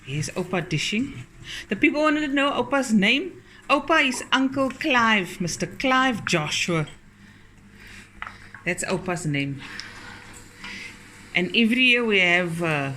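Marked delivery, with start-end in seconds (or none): 1.7-6.9 excited. Real, not acted. Excited announcement of name.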